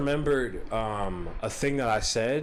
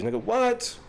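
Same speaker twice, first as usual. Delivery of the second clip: ordinary delivery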